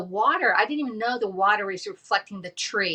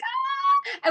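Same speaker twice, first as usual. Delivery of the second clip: high-pitched delighted squeal of greeting; excited squeal. Retold or imitated. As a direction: squeal like meeting a long-lost friend. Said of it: "she's like".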